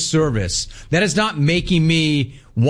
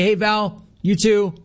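friendly reply; 8 semitones higher than usual. Retold or imitated. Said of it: "Hi, Jim".

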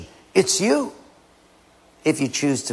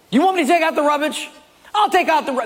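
angry husband; 12 semitones higher, much faster than usual. Acted. Acted husband blowing up.

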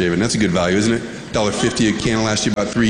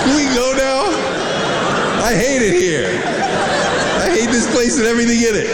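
put-on whiny kid voice; 11 semitones higher than usual. Kid complaining to dad about the smell, high whine. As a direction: whine it like a bored little kid.

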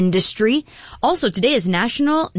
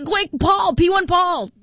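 calling out to Paul; 11 semitones higher than usual. Real, not acted. Host calls producer Paul about a missed birthday, urgent high shout. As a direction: yell for Paul to come quick.

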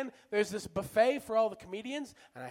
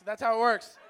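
usual speaking voice

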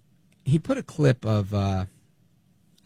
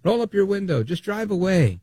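raised, higher pitch retelling a yell; imitating a guy yelling at agents, pitched up; mock yell; 8 semitones higher than usual. Man yelling at ICE agents to drive away, mock shout. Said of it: "he's yelling at them".

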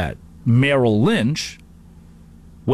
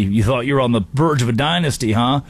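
usual speaking voice

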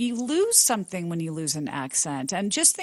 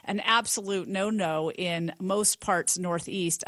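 usual speaking voice

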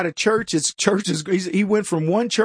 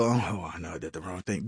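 much lower and softer, a sheepish confession; mock sheepish admission; 13 semitones lower, 11 dB quieter than usual. Host voicing a decent person admitting fault, low and sheepish. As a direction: own up under your breath. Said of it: "and you go".

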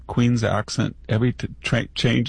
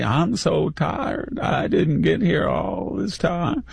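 mock Hillary drawl; 6 semitones higher, slower than usual. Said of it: "or it's Hillary".

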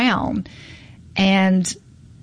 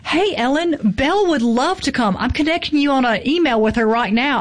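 higher-pitched, eager acted-out email; eager good news; 6 semitones higher than usual. Agent emails Ellen that Belle would love to come, excited.

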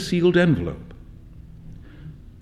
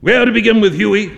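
higher, louder, hearty friend; hearty Trevor; 5 semitones higher, 5 dB louder, faster than usual. A narrator's reading. Trevor answering his sulking friend Hughie, hearty. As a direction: jovially brush off the complaint.

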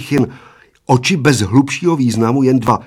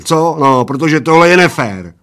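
loud protest; 5 semitones higher, 6 dB louder than usual. Acted. Giant protests unfair bet.

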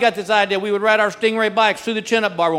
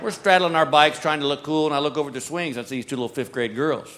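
ordinary talk, nothing marked

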